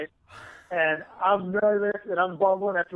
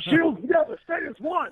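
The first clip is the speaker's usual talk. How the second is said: mock arena rallying call, much higher; Gladiator battle cry; 10 semitones higher than usual. Joking before walking into the arena, hammed-up Gladiator line. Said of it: "that scene in Gladiator".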